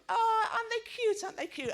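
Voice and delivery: put-on high laughing coo over a baby; old lady gushing over the baby, laughing. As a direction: gush like a granny at a pram. Old ladies cooing at baby.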